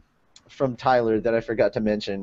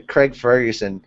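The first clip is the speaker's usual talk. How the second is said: ordinary talk, nothing marked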